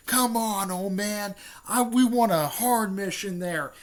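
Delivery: put-on high, loud protest to an old man; impatient demand. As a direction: plead loudly for a harder job. Naruto complains to the Hokage.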